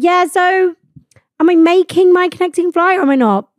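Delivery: retold tipsy challenge to staff; pointed query. Tipsy passenger back at the desk about connection, pointed.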